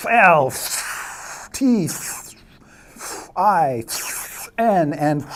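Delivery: loud, high letters one at a time; emphatic letter bursts, falling in pitch; punched-out skywriting letters. Acted. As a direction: shoot each letter out with a pause after. Narrates letters being written in sky.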